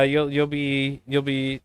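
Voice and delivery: usual speaking voice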